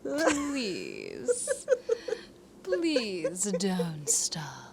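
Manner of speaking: slow breathy whisper. Pretend. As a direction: whisper a greedy plea. Jokingly begging for more praise.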